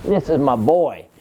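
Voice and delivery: ordinary talk, nothing marked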